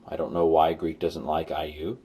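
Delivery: ordinary talk, nothing marked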